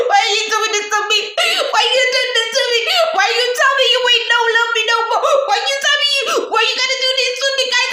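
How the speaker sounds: high, fast, wailing sob-talk; mock crying voice note. Pleading with her ex in a tearful voice note, hammed-up wailing. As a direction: wail it like a heartbroken voice note. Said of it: "I texted him voice notes crying".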